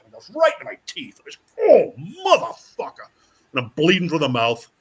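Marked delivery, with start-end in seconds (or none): 1.6-3.1 slow, high, loud mock curse; pained cursing. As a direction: curse as if just hit in the mouth. Retold reaction to blow in teeth.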